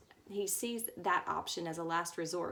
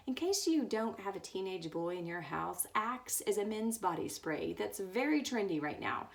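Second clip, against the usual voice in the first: ordinary talk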